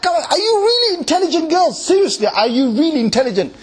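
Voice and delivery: high, laughing mock scolding; mocking disbelief. Scolding fangirls in a mocking laughing voice. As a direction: tease them with a laughing mock rebuke.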